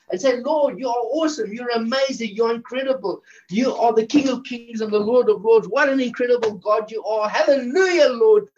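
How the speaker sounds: high, fervent worship; carried away in high-pitched adoration; excited praise. Real, not acted. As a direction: pour out exultant praise to God. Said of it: "because I'm so excited".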